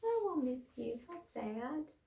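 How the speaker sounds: teacher repeating Mouse's line, sad and soft. Shown as a demonstration. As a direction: say it gently and sadly. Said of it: "in a sad, soft voice".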